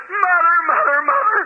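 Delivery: rising joyful cry, pitch climbing high; overjoyed cry. Retold or imitated. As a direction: call her name in disbelieving joy. Husband sees wife revive.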